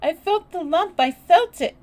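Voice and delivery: high, loud distressed cry; panicky, tearful insistence; choked out. A narrator's reading. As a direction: choke it out through sobs. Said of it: "choked out Colin".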